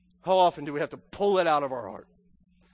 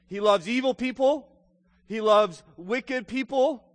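fervent pulpit voice, louder and punched; preaching at full force; 5 semitones higher, 6 dB louder than usual. Acted. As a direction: hammer home each bad people.

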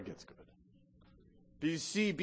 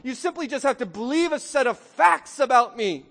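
emphatic preaching; 8 semitones higher, 7 dB louder than usual. Acted. Preacher voices Jesus telling Zacchaeus he's moving in, emphatic. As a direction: preach it insistently, voice raised.

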